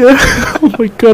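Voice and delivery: ordinary delivery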